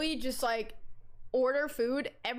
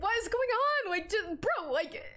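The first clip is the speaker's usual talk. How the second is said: baffled; 8 semitones higher than usual. Retold or imitated. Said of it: "I'm like".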